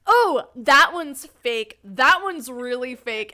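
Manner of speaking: slow, loud, scoffing verdict on each dummy; mock dismissive guy. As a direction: drag it out like you're unimpressed. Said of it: "goes to each dummy and goes".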